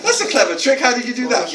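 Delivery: high, playfully impressed question; mock amazement. Author to head teacher as lights go out, mock amazement.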